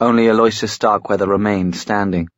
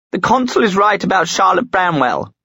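exclaimed; 10 semitones higher than usual. A narrator's reading. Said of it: "she exclaimed".

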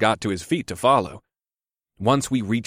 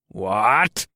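spat. A narrator's reading. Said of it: "Vimin spat".

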